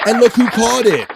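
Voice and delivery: high, loud call-out; excited reveal. Real, not acted. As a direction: shout it like a winning draw. Host reveals who caught gift.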